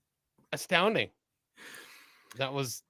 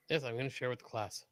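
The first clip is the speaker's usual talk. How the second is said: usual speaking voice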